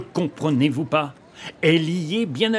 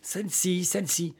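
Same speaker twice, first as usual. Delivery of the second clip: softer, toned-down repeat; hushed echo; 6 dB quieter than usual. Acted. Young man correcting his noisy companion, hushed. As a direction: say it back softly.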